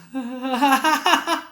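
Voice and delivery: high demo laugh in crescendo; laugh growing soft to loud. Shown as a demonstration. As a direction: start with a small laugh and build it up. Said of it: "dari pelan ke keras". (tertawa dari pelan membesar ke keras)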